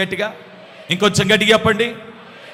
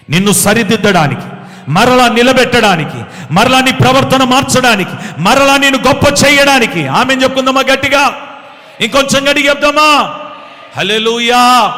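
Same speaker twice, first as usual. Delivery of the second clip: loud, higher fervent preacher's climax; full-force preaching; 5 semitones higher, 8 dB louder than usual. Acted. Preacher rousing the congregation toward amen, loud and fervent. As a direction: drive it home to the congregation.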